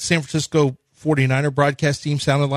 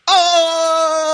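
very high, loud sung "Oh"; mock Japanese wail; 13 semitones higher, 4 dB louder than usual. Mock Japanese commentator wailing at the miss. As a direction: let out a long high Japanese-style cry. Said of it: "Oh, in Japan".

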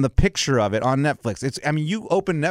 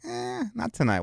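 retold reluctant reply; 6 semitones higher, 8 dB quieter than usual. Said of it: "I'm like".

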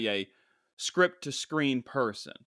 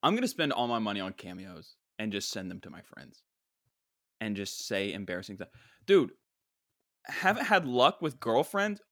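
ordinary delivery

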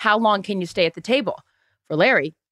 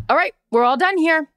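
higher, brisk take-charge order to clear out; commanding announcement; 8 semitones higher than usual. Retold or imitated. Larry ordering diners out of the booth, higher and commanding. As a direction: announce it like the boss of the room.

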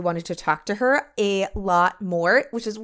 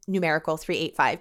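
ordinary delivery

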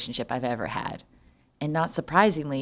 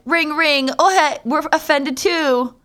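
high mock ghost town voice; 9 semitones higher, 5 dB louder than usual. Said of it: "ghost towns will be like".

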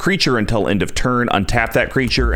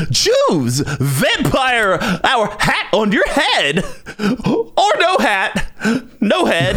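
Mad Hatter voice; 10 semitones higher, much slower than usual. Pretend. Voicing Mad Hatter card quote.

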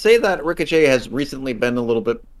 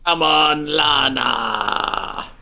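long drawn-out mock call; Rusev; 3 semitones higher, much slower than usual. Co-host riffing on the wrestler's catchphrase, drawn-out call. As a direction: stretch the last word out, wailing lightly. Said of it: "I want more "come on, mans" from Rusev".

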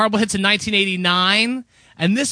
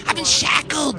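ordinary delivery

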